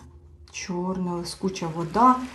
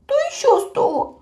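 high, tearful sob of a question; small creature crying in despair; sobbing; 4 dB louder than usual. A narrator's reading. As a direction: sniffle it out. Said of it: "хлипнув він".

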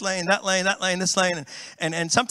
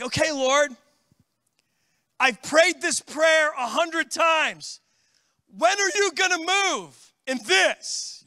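higher, slow, exasperated plea to God; weary pleading prayer; 7 semitones higher, slower than usual. Retold or imitated. Pastor retelling his own impatient prayer, slow higher plea. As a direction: pray it worn out and impatient. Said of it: "where I'm like".

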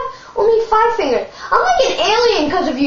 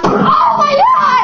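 alarmed shout; 9 semitones higher, much slower than usual. Pretend. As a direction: yell it in sudden shock. Pretend battle, sudden alarm.

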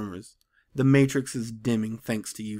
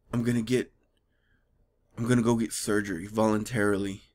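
lower, halting mock-grave delivery; stilted mock seriousness; 5 semitones lower than usual. Friends solemnly discussing absurd surgery, stilted mock seriousness. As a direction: pause heavily between phrases, deadly earnest. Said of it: "nobody talks like that".

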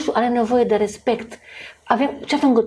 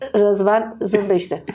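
usual speaking voice

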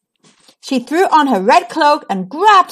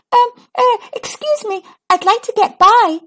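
timid, higher little voice; small scared voice; 10 semitones higher than usual. A narrator's reading. Ruby asks the wolf to let her pass, small timid voice. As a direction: ask politely but shrink inside. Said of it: "in a smaller voice than she would have liked".

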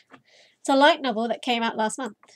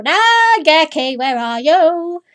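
slow, drawn-out, loud, higher call; 5 semitones higher, 9 dB louder, much slower than usual. Pretend. Player calling for Nageki in the game library, drawn out and loud. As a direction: stretch the name out across the room.

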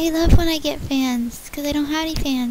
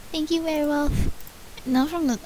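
normal talking voice

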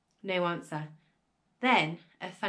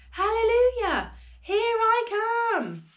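high, loud, drawn-out cheer; jubilant shout; 11 semitones higher, 6 dB louder, slower than usual. A narrator's reading. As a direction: holler it triumphantly, stretching the ends. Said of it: "she shouted, finding her voice at last".

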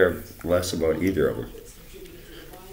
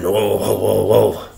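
louder, higher whoa whoa; a sudden raised whoa, higher and louder; exclaiming whoa; 5 semitones higher, 6 dB louder, slower than usual. Real, not acted. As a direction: pull yourself up short in mock alarm. Exclaiming mid-rant.

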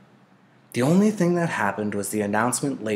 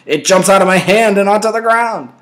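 higher, much louder, laughing friend's voice; laughing call; 10 semitones higher, 11 dB louder than usual. A narrator's reading. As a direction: yell it back laughing across the yard.

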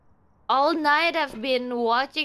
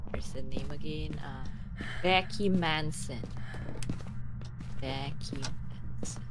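low, soft, near-whispered name check; hushed muttering; 9 semitones lower, 7 dB quieter than usual. Real, not acted. Player checks the ghost's name, muttering quietly. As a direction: murmur it softly as if to yourself.

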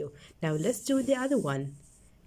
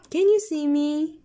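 high question; 6 semitones higher, 5 dB louder than usual. Shown as a demonstration. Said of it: "Let's read the sentences".

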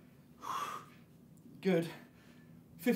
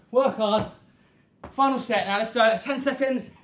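loud urging; 3 semitones higher, 6 dB louder than usual. Real, not acted. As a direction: fire them up. Coach final set push.

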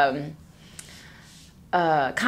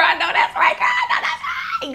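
squealing with laughter in agreement; laughing shout; faster than usual. Real, not acted. To her viewers, agreeing with a laughing shout.

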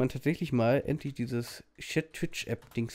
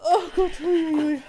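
laughing; 7 dB louder than usual. Real, not acted. Laughing after near sneeze.

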